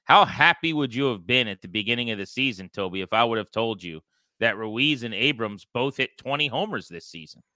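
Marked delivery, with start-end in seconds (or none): none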